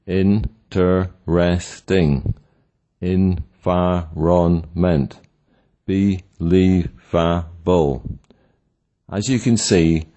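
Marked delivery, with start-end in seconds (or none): 0.1-8.0 slow, four separate beats in "Interesting", "environment", "believable"; split into syllables. Shown as a demonstration. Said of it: "The following are four-syllable words".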